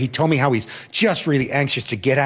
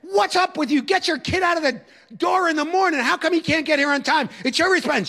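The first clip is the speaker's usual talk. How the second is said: much higher, angry shouting at a parent; tough guy yelling; 12 semitones higher than usual. Retold or imitated. Teacher yelling at the mother about lateness, angry shout. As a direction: bark it like a strict teacher scolding. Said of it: "I'm yelling at her like the tough guy teacher".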